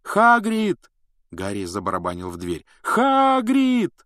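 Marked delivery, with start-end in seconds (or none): 0.0-0.9 high, loud call. A narrator's reading. Harry calling through door. 2.8-3.9 high, long loud shout. A narrator's reading. Harry calling again, pounding.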